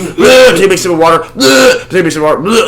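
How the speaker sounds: high retching blehs between sips; mock vomiting noises. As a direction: imitate a friend throwing up. Imitating friend vomiting.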